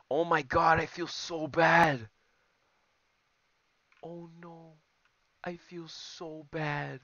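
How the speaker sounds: high, drawn-out lament of guilt; guilty wail. Real, not acted. Gamer pities the opponent he must beat, guilty wail. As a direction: wail it high, slowing down with pity.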